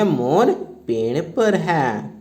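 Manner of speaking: slow, word-by-word dictation. Shown as a demonstration. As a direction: dictate each word slowly for the class. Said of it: "तो लिखेंगे". (धीमे-धीमे बोलकर लिखवाना)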